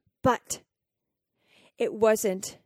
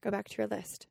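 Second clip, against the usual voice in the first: inner voice; 5 dB quieter than usual. Retold or imitated. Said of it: "And I hear".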